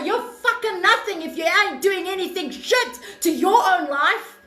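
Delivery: ordinary talk, nothing marked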